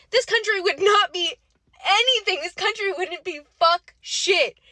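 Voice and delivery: loud, high-pitched, vehement insistence; impassioned outrage. Real, not acted. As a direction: raise pitch and volume in real indignation. Impassioned outrage over treatment of Americans.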